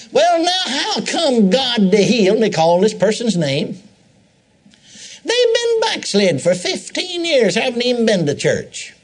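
high, whiny mock self-pity; high, whining church member complaining to the preacher; self-pity whine. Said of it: "they start crying in self-pity".

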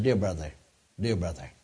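soft, low, tender plea; tearful tenderness. Retold or imitated. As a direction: soothe him gently, near tears. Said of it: "with tears".

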